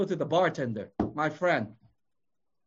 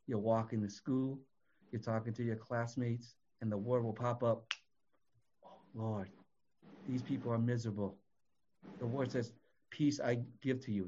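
much softer, lower, intimate sermon voice; soft preaching; 9 semitones lower, 10 dB quieter than usual. Acted. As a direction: draw the congregation in quietly. Softly preaching an everyday scene.

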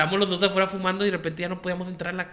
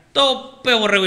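loud, high teasing call; mock the mother butting her kids' heads; playful mom shout; 6 semitones higher, 7 dB louder, much slower than usual. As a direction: mom shouting a playful tease as she bumps the boys' heads. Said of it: "decía".

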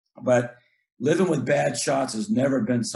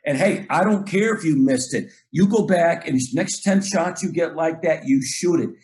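fired-up coach's shout-out on the court; emphatic praise; 3 semitones higher than usual. Acted. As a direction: praise him hard, insistently. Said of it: "I really try to praise that guy".

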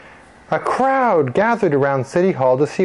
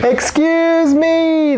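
high, very drawn-out call; indignant librarian cutting in; insistent interruption; 3 dB louder, much slower than usual. Acted. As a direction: call out to stop everyone.

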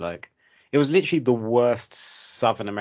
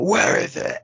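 sudden high shouted outburst, much higher and louder; 11 semitones higher, 5 dB louder, slower than usual. Retold or imitated. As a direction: snap it out like a frustrated actor losing his temper. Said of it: "he'd get angry and be like".

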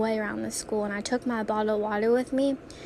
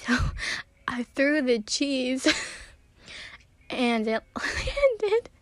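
laughing; 2 semitones higher, slower than usual. Real, not acted. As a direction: burst out laughing mid-word. Laughing at the thrown cheese.

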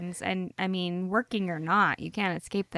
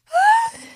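a sudden, very high and loud gasp of a shriek; imitated shriek; 13 dB louder than usual. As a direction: mimic a toddler's shriek in one sharp burst. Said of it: "and just scream".

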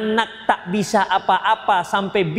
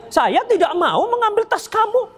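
put-on sulky child refusing; 10 semitones higher than usual. Child refusing parent's request.

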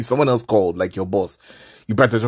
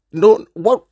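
much higher, loud "what?" in disbelief; incredulous shriek; 12 semitones higher, 9 dB louder than usual. Real, not acted. Mocking a friend's question to listeners, incredulous. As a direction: squeal it, unable to believe it. Ranting about a silly question.

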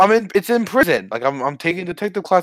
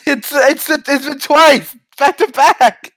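high, loud objection; animated protest; 8 semitones higher, 5 dB louder, slower than usual. Real, not acted. Player corrects a complaint about the bit, high loud protest. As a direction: protest loudly, pitch jumping up.

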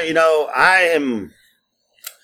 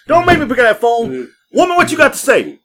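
higher, hammed-up bossy challenge; mock tough husband; 8 semitones higher than usual. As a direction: pretend to lay down the law. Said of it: "I still talk shit".

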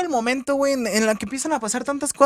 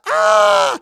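much higher, louder hammed-up scream; parodying the girl jumping; mock falling scream; 7 dB louder than usual. Girl jumps out the window.

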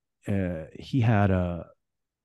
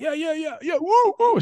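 very high, loud whooping yeah yeah; mock yelling; 5 dB louder than usual. [name] the DJ whooping off the warning, very high and loud. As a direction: whoop it off like a partying DJ. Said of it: "screaming, yelling".

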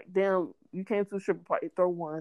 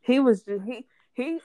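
ordinary talk, nothing marked

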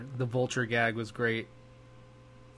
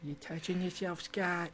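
high mock teasing voice; 5 semitones higher, 5 dB quieter than usual. Teasing absent friend.

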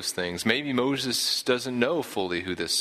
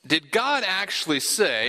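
higher-pitched, mildly incredulous question; incredulous serpent; 8 semitones higher than usual. Acted. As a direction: question it as if you can hardly believe it. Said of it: "he's kind of incredulous".

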